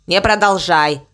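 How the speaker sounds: short, low interruption; curt. A narrator's reading. Crow tells cow to stop, curtly. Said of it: "коротко ответил ворон".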